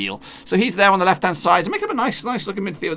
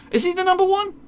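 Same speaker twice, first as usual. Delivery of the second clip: sudden doubting question, pitched way up; surprised question. Real, not acted. Doubting the stats to his viewers, a high surprised question. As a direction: squeak it up in disbelief.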